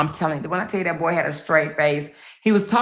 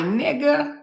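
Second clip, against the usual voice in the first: scolding husband; 2 semitones higher than usual. Retold or imitated. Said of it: "I'm like".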